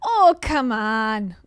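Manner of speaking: put-on slow, drawn-out Ontario accent; mock Ontario accent. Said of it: "The Ontario accent is like this".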